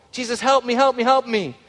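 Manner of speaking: high, pleading cry to Jesus; a mother pleading with Jesus for her possessed daughter; desperate pleading mother. Acted. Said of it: "wouldn't you pray like that".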